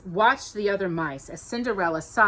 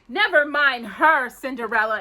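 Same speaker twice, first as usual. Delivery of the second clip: bossy stepsister; 6 semitones higher, 6 dB louder than usual. Acted. Stepsister bossing Cinderella.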